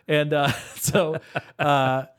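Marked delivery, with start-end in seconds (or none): none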